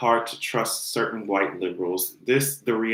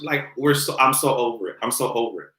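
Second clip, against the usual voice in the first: ordinary talk, nothing marked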